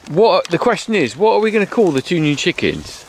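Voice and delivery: ordinary talk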